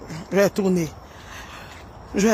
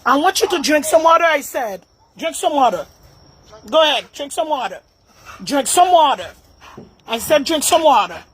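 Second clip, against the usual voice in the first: louder insistent order, pitched up; firm repeated command; 5 semitones higher, 3 dB louder than usual. Real, not acted. Owner keeps telling the dog to drink, firm raised command.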